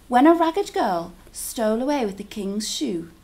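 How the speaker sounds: plain reading voice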